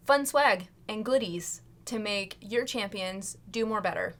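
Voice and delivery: ordinary talk, nothing marked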